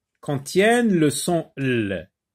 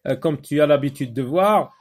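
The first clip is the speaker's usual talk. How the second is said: ordinary talk, nothing marked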